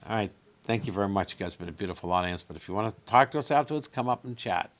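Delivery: fast, breezy closing patter; quick stage sign-off. Retold or imitated. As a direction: rattle off the thank-you. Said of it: "we make it a policy, like".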